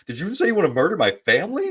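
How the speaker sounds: high, fast, hammed-up outrage; mock ref overreaction. Ref overreacting to a mild complaint, high and fast mock alarm. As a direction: rattle it off like a horrified ref.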